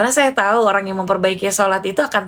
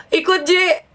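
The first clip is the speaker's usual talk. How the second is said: pleading friend; 9 semitones higher, slower than usual. Retold or imitated. Crying friend begs to tag along.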